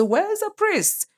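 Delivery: high-pitched imitation of trusting onlookers; mock naive questioning. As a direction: put on a high, innocent questioning voice. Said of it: "They are going to say".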